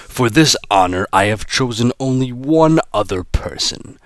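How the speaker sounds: a hint of a gloating villain; lightly voiced menacing boss.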